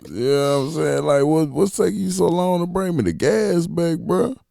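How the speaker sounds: high, loud, fed-up yelling; mock yell on the phone. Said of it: "I said, I called the phone".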